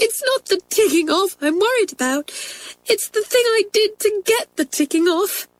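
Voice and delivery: high tearful confession; sobbing. Acted. Crying girl explains her trouble to twins, sobbing. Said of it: "sobbed Kathleen".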